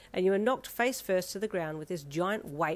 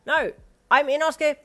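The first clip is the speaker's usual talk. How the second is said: put-on boastful boy voice; 8 semitones higher, 11 dB louder than usual. A narrator's reading. Boy insists on name.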